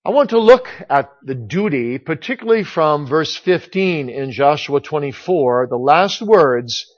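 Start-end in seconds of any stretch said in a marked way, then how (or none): none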